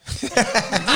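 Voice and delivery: a quick burst of real laughter, falling. Real, not acted. Laughing at the host's jab, a sudden real laugh.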